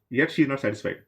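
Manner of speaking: usual speaking voice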